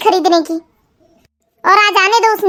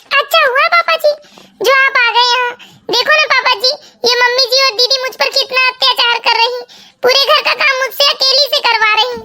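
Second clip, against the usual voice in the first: high-pitched, put-on weepy pleading; acted crying daughter-in-law, voice pitched up; tearful complaint; 3 semitones higher than usual. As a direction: whine tearfully to father-in-law for sympathy. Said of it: "पहले रोना बंद करो बहू".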